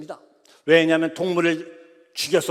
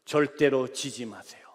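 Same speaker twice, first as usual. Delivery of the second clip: earnest message; 8 semitones lower, 5 dB quieter than usual. Retold or imitated. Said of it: "문자 그대로 말씀드릴게요".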